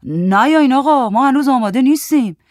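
high, loud, flustered protest. A narrator's reading. Man stopping workmen at the door, flustered. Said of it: "وحید دستپاچه گفت".

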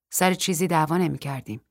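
ordinary delivery, nothing marked

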